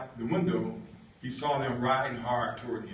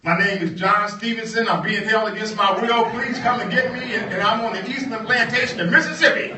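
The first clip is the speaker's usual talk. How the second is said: higher and much louder, calling for help; urgent on-air plea; 10 semitones higher, 13 dB louder than usual. Retold or imitated. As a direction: broadcast it like a desperate cry for rescue. John's plea broadcast on air.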